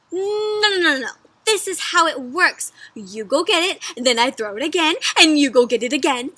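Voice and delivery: loud, eager dog explaining; super excited. A narrator's reading. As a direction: rattle off the rules with excitement. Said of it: "So dog's super excited about that".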